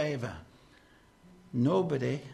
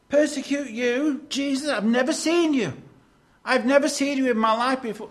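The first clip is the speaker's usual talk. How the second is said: higher, louder, protesting innocence; baffled protest, raised and fast; indignant disbelief; 7 semitones higher, 4 dB louder than usual. Acted. Saul protests to Jesus that he never met him, in a raised disbelieving voice.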